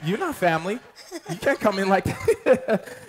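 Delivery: mock indignant protest. Groom to barging coworker, mock protest. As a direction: push back with playful outrage. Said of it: "I was like".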